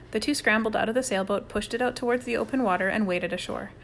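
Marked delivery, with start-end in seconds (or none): none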